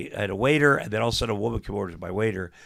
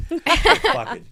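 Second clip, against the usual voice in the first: laughing curse; 9 dB louder than usual. Real, not acted. Laughing at his own joke.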